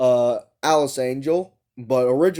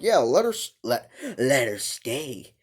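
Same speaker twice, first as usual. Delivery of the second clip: Wilson. Acted. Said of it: "He kinda talks like that".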